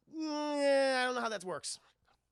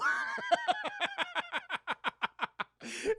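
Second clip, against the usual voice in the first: high laugh; 3 dB louder than usual. Real, not acted. Laughter at the scene.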